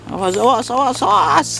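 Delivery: high, urgent cry of warning; alarmed warning. Real, not acted. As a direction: warn them sharply as it bursts. Steam bursting from pouch.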